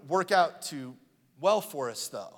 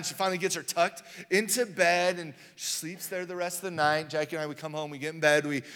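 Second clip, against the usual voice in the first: ordinary talk, nothing marked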